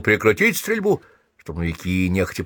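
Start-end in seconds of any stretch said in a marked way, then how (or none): 0.0-1.0 mild shouted order. A narrator's reading. Sergeant to the stormtroopers shooting on the road, restrained shouted order. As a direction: read a restrained shouted command. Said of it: "крикнул".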